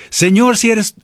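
high, acted cry for rescue; dramatized desperate appeal; urgent plea. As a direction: plead high, as anyone in danger would. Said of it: "Cualquiera le hubiera dicho".

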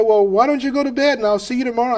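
high, lilting suggestion; retold friend signing off. Friend gives up, sends to bed.

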